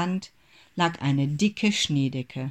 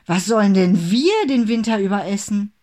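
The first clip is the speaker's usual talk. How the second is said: loud, higher angry scold; indignant scolding shout; 5 semitones higher, 8 dB louder than usual. A narrator's reading. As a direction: tell him off as a furious big brother. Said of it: "schimpfte Max".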